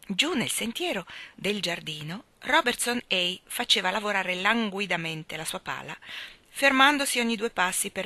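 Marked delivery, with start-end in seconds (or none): none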